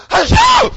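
huge, high acted sneeze; loud fake sneeze. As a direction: let rip a big cartoon sneeze. Turtle finally sneezes.

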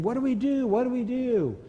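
high, frantic mock cries of the team; panicked yelling. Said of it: "began to yell and scream".

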